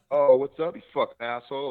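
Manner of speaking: loud, high mock Boston cursing; Boston accent. Little James turned nasty on Aaron, loud Boston accent. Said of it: "curse in, like, a Boston accent".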